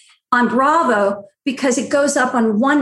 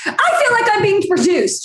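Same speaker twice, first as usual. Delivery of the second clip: retold scream; 8 semitones higher than usual. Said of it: "She screams in the room".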